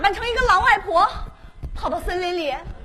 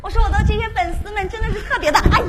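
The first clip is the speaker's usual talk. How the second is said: put-on baby voice; 3 semitones higher than usual. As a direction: gush like a giggly pageant winner. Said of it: "我的娃娃音". (娃娃音)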